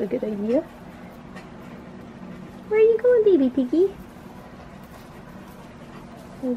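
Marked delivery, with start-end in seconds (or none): 2.7-3.9 high, louder cooing. Real, not acted. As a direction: coo at the little piggy. Cooing at pet.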